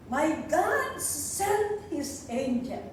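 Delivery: slow, stressing "God"; emphatic reading. Shown as a demonstration. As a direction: read it slowly for emphasis. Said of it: "tingnan ninyo".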